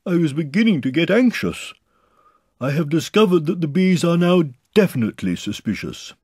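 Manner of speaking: high, loud bear voice; Pooh reporting earnestly. A narrator's reading. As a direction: announce the discovery from up high.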